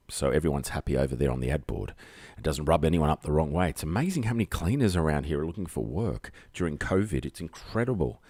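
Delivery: ordinary talk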